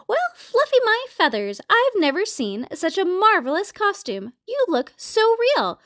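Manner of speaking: high-pitched, delighted amazement; exclaiming. A narrator's reading. Mabel admires Turkey's costume, exclaiming in delight. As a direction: exclaim in high, gushing wonder. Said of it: "she exclaimed".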